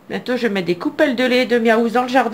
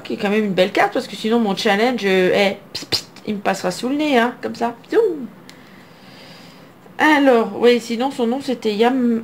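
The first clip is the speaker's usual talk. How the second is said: ordinary talk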